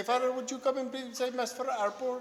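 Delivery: high, put-on little nun voice; imitating pleading sisters. Sisters asking a priest to say Mass, high mock nun voice. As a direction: ask sweetly in a high mimicked voice. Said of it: "they end up talking like this".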